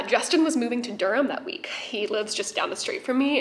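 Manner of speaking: usual speaking voice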